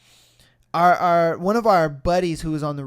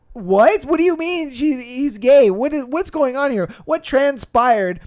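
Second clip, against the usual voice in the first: higher, louder baffled questions; confused disbelief; 5 semitones higher, 6 dB louder than usual. Retold or imitated. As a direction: pile on the questions, thrown.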